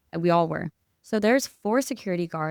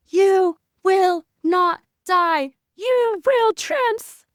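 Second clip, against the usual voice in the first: much higher, loud, very slow commanding cry; screamed prayer; 12 semitones higher, 6 dB louder, much slower than usual. Retold or imitated. Retelling the prayer screamed over the seizing man, loud commanding cry. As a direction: command life into him. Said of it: "and I'm screaming".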